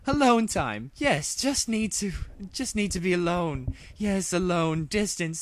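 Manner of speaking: high, regal princess voice, thinking aloud; a royal mare talking herself into a plan; musing princess. Acted. Luna pacing away from Rainbow Dash, musing aloud.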